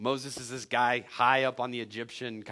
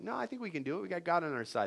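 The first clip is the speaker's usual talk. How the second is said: higher, lighter voice of the two brave spies; hopeful spies; 5 semitones higher, 4 dB quieter, faster than usual. Retold or imitated. Two brave spies answering the others, higher hopeful voice. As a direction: sound eager and hopeful. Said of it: "two of the guys are like".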